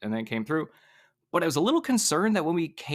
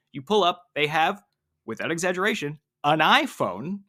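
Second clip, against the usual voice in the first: usual speaking voice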